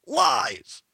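high-pitched mock heckle; one-word outburst, pitched well up; imitated yell. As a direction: yell it out like a heckler from his seat. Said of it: "he yelled from his seat".

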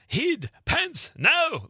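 high-pitched Scottish movie-dad yell; yelling Scottish dad impression. Guest-show host quoting the movie's big-head scene, high Scottish yelling. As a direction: bark it in a thick, high Scottish accent. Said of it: "he's just yelling at the kid".

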